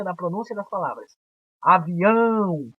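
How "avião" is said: "Avião" slowly, stretching the final ão; slow "Avião" with a long stressed ending; stressed final syllable. Shown as a demonstration. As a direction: draw out the ão of "Avião". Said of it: "O ão é a sílaba tônica".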